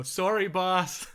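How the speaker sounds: loud and high, slow tough-guy apology; hammed-up goon saying sorry; New York gangster. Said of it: "I fully go".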